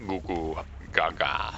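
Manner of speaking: low, slow mock baby babble. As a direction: babble like an infant. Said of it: "is that your best Fabio voice".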